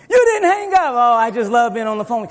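put-on high giggly girlfriend voice; giggly late night call. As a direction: squeal it, laughing and delighted. Acting out late night phone call.

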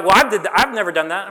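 loud, boastful mock objection; mock protest. Preacher mimicking listeners who claim they never did that, mock protest. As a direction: blurt it like an indignant churchgoer. Mimicking self-righteous congregation members.

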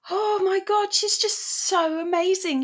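high, gushing praise; excited gushing. Retold or imitated. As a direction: gush like an excited fan. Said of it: "I said to Bronya".

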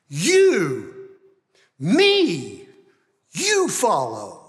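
You, me, you follow spaced apart, You and me punched loud; emphatic. Shown as a demonstration. Pastor stressing the Greek command, emphatic pauses. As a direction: hit You and me hard, then you follow. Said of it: "that makes it very emphatic".

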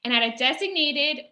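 ordinary talk

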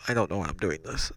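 plain reading voice

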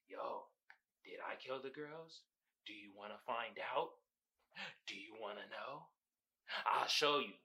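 low, hushed creepy taunt; sly villain taunt. Retold or imitated. Villain taunting the detective in a low hush. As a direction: murmur it like a killer baiting a cop.

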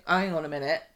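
normal talking voice

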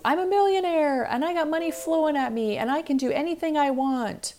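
loud, high mock-millionaire boast; over-the-top affirmation. Podcaster mocking an overnight money mindset, hammed-up excitement. Acts out exaggerated positive self-talk.

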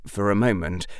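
usual speaking voice

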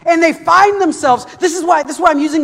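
loud, driving sermon voice; fiery preaching. Acted. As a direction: preach it with full force. Preaching at full force.

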